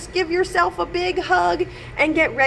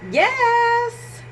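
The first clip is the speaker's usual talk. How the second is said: put-on high little kid's yes; child's voice; 8 semitones higher than usual. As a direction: answer like a small boy. Child's answer.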